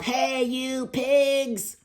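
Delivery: slow, high, loud shout at the pigs; yell. Acted. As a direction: drag out the shout to scare them. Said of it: "and yelled".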